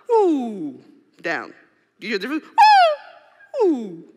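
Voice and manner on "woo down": downward woo. Shown as a demonstration. Speaker shows the wrong way, a falling woo. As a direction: let the woo fall down. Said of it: "that's up".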